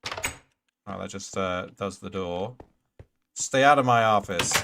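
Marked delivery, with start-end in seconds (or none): 3.3-4.5 loud hammed-up CEO bark; playful loud yell at the mobs; mock boss yell. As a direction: shout them out like an angry boss. Mock boss shouting at intruders.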